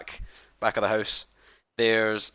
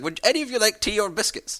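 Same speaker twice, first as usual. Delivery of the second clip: put-on higher, kindly old man voice; friendly old man; 10 semitones higher than usual. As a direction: play a chatty old host welcoming travellers. Old man offers tea.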